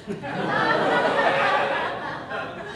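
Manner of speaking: room laughing at the punchline; big group laugh; audience laughter. Real, not acted.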